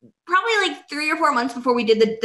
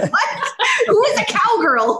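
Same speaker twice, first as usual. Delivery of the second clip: laughing; 10 semitones higher than usual. Retold or imitated. Confused reply, laughing.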